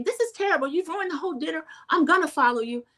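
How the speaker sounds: very high, dramatic mimicry; scolding Heather. Vlogger mimics Heather scolding Lisa, very high. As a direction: scold her and chase after.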